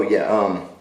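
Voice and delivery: ordinary talk, nothing marked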